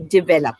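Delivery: develop with stress on the second syllable, di-VEL-op; correct develop. Shown as a demonstration. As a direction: say develop as di-VEL-op, clean and even. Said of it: "For example".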